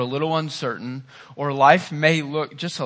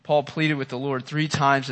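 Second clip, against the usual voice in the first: ordinary delivery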